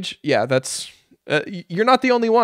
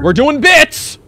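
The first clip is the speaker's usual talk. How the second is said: loud, high interjection; mocking shout; 8 semitones higher, 9 dB louder, slower than usual. Real, not acted. Streamer heckling the video being watched, loud mocking shout. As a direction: shout it over the video, teasing.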